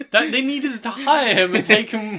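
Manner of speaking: loud, breaking up with laughter; laughing while talking. Real, not acted. Joking to friends about hiring the TikTok man, loud laughing. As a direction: crack up through the words. Cracking up at own impression.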